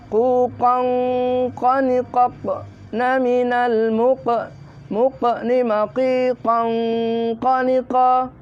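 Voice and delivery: high, slow chanted "Quqan", nasal N held; straight into ikhfa. Shown as a demonstration. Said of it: "Ini harus masuk langsung ikhfanya". (lafal "Quqan" langsung masuk ikhfa)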